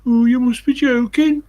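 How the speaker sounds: high, loud mock Brummie; Birmingham accent. Woman answering praise of her accent, Birmingham accent. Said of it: "I love Birmingham's accent".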